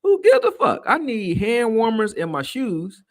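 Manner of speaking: loud, high, whiny cry about the freezing cold; mock cold complaint. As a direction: overplay how cold it was, stretched out. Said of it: "but it was like".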